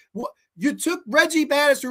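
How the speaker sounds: normal talking voice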